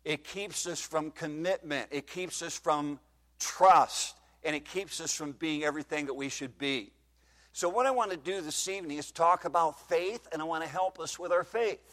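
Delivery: ordinary talk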